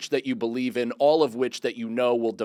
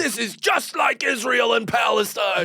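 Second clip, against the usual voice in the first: mock shout; 10 semitones higher than usual. Friend jokes what the contestant said, mock shouting. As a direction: proclaim it with mock gravity. Joke overblown line.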